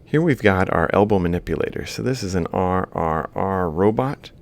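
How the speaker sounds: ordinary talk, nothing marked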